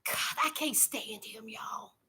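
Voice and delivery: hushed, disgusted whisper; whispered aside. Real, not acted. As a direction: drop to a whisper, fed up with him. Disgusted aside about him.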